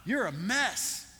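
exasperated mock yell. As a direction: act out a fed-up owner's outburst. Owner shuts parrot in freezer.

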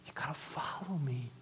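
whispered words of Jesus, soft and close; hushed whisper. Acted. Pastor voicing Jesus to the congregation, whispered. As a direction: lean in and breathe it like a secret. Quoting Jesus after "listen.

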